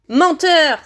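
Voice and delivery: loud, high accusation; outraged cry. A narrator's reading. Boy defies his parents' killer, crying out. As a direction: fling it out as a shout. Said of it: "s'écria Harry".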